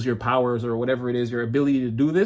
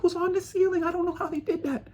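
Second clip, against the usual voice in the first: high, hushed amazement; stunned; 4 dB quieter than usual. Retold or imitated. Onlooker in shock at furniture on the ceiling, stunned. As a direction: gasp it out like you can't believe it.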